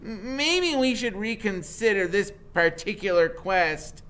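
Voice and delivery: put-on nervous nerdy boy. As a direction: stammer it out like a jittery genius. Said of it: "Freak says".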